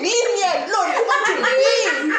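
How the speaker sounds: laughing, high mock protest; mock tantrum. Girl retelling her tantrum to friends, laughing mock protest. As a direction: ham up the teen storming off.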